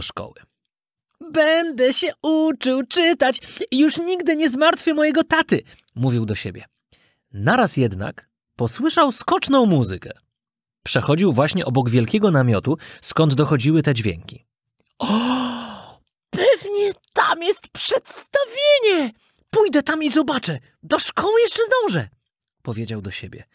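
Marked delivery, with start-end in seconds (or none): 1.3-5.6 put-on very high, resolute child voice; eager puppet boy. Puppet boy vowing to learn, high and eager. 15.0-22.1 put-on very high, wondering child voice; squeaky little boy drawn to a show; excited puppet boy. Puppet boy spotting a show, high and excited.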